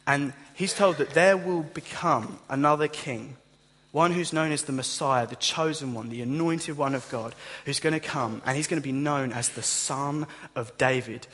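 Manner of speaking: usual speaking voice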